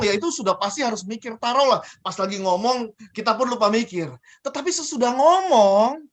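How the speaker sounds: ordinary delivery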